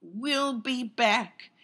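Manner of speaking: slow, high, eerie taunt; creepy villain voice. Pretend. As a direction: drag it out like a ghostly threat. Hams villain's ominous farewell.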